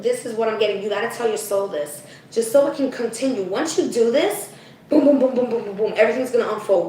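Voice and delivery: normal talking voice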